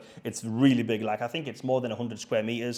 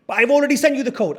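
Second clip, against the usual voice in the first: high, loud, quick protest; exasperated insistence; 11 semitones higher, 8 dB louder, faster than usual. Retold or imitated. Host arguing with guest over the code, exasperated. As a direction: imitate an indignant host protesting fast.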